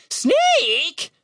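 very high, rising bird voice; incredulous raven. A narrator's reading. Raven accused of sneaking up, incredulous. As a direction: squawk the question in disbelief. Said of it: "said the bird incredulously".

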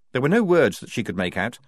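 ordinary delivery, nothing marked

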